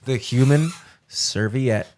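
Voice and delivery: slow, savoring repeat of the nickname. Pretend. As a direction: roll the nickname out slowly, tasting it. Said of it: "repeats the nickname slowly, savoring".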